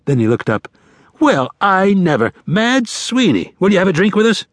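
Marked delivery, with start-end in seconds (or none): 1.2-4.5 theatrical, high-pitched hearty welcome; delighted greeting. A narrator's reading. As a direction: greet him with showy surprise, slowing on the name. Wednesday hails an old acquaintance.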